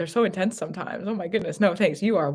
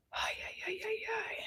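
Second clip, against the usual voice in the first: high breathy whispered cries of dismay; a tiny whispered wail at the glitch; 16 dB quieter than usual. Real, not acted. Psychic reacting to the glitchy stream with a whispered wail.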